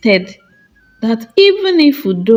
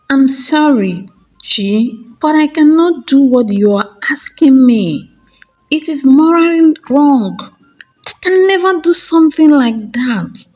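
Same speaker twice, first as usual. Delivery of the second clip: refusing sister; 6 semitones higher, 3 dB louder than usual. Acted. Chidinma refusing sister's plea.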